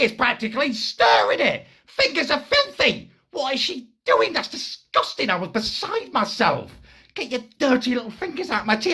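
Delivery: high-pitched appalled rant; beside himself with mock horror; disgusted outrage. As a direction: rant in squeaky, comic disgust.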